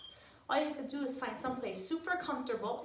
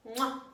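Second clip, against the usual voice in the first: loud kissing sound; blown kiss; 3 semitones higher, 5 dB louder than usual. Real, not acted. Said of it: "sending you a big hug".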